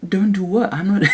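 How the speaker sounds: high mock British protest; imitated British accent. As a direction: echo back in a British accent. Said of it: "talking to you with their accent".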